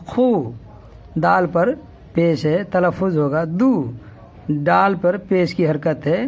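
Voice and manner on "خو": "خو" with a throat kh and long oo; khoo pronunciation. Shown as a demonstration. Teacher showing khe with pesh above, khoo pronunciation.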